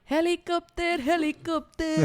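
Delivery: loud, high put-on catchphrase voice; catchphrase impression. Showing friends their own bit, loud comic catchphrase voice. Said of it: "This is, this is mine".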